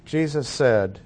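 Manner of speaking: usual speaking voice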